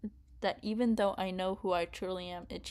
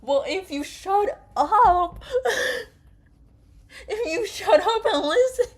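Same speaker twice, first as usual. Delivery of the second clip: laughing yell; 11 semitones higher, 10 dB louder than usual. Real, not acted. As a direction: yell at the character, half cracking up. Yelling at June, laughing.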